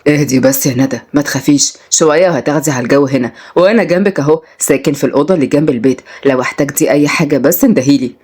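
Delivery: low, soothing male-character voice; calm her down in a low voice; reassuring Oday. Acted. (بيطمنها بصوت واطي وهادي)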